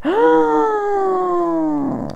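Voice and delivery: sudden high ah of alarm; startled yelp; high cry. Real, not acted. Tarot reader as a card slips, high startled cry.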